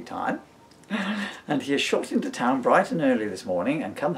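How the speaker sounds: put-on storybook reading in the baby's diary voice; reading aloud. Dad reading his book to his son, lightly voiced story reading. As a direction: read the diary entry out with a dry wink.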